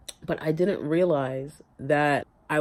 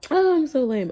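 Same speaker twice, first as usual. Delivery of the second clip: tearful; 12 semitones higher, 8 dB louder than usual. Real, not acted.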